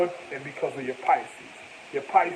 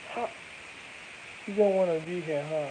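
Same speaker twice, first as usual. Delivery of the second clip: sarcastic; 5 dB quieter than usual. Pretend. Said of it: "get sarcastic with her".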